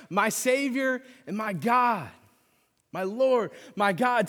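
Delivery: high, loud slow shout. Retold or imitated. Thomas shouting his faith at Jesus, loud and high. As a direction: burst out with rushing hope. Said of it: "and he shouts".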